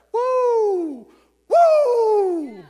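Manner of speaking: loud, high whooping woo; pumped-up woo. Retold or imitated. Pastor showing the church how pumped they should leave, loud high whoop. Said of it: "feel super pumped up like".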